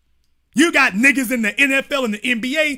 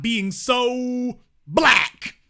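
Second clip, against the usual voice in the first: drawn-out mock; 2 semitones higher, much slower than usual. Reading critics' wish, mock drawl. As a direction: stretch it out mockingly.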